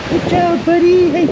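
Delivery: very high squeaky praise; dog praise voice. Real, not acted.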